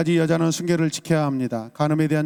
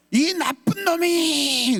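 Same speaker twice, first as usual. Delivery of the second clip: high, very slow drawn-out yell; proper shout; 12 semitones higher, much slower than usual. Shown as a demonstration. Pastor demonstrates the right way to cry out, high and very slow. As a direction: stretch the yell out high and long. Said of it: "그래야 돼요".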